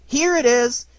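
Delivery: high, loud excited cry. A narrator's reading. Christopher Robin finding the present, an excited cry. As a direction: burst out with delight. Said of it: "cried Christopher Robin excitedly".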